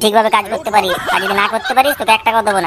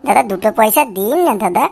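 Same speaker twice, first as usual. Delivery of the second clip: whining beggar; 3 semitones higher than usual. Acted. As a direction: wheedle coins from passers-by. Whining beggar recording from box.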